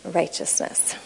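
breathy whisper of Righteousness; whispered word. Acted. Teacher ends the point on a whispered word. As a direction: let Righteousness fall to a whisper.

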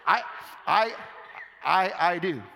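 halting, tearful stammer; real tears catching the words; choked up. Real, not acted. Preacher choking up about his own tears, tearful. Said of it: "I'm crying".

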